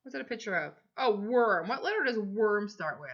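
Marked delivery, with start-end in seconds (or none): none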